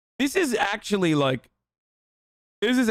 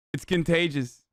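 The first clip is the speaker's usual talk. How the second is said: normal talking voice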